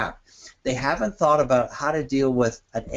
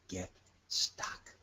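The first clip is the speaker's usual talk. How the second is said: whispered aside; 6 semitones lower, 16 dB quieter than usual. Shown as a demonstration. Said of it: "drops voice on get stuck".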